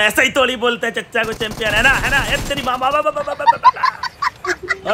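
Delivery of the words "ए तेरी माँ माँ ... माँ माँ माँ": real panicked yelp; panicked wail. Real, not acted. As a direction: cry out in sudden alarm as the damage hits. Panicked cry in gunfight.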